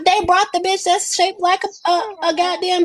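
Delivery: high, loud shocked squeal; excited outburst. Real, not acted. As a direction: squeal it out in disbelief.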